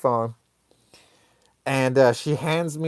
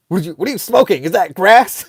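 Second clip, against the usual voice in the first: higher, punchy mock interrogation; mock indignation, louder at the end; angry mom; 4 semitones higher, 5 dB louder, faster than usual. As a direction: imitate an outraged mom's question. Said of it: "She was pissed".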